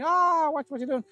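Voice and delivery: high, alarmed yelp in pain; shrill boys crying out, scared; panicked cry. Acted.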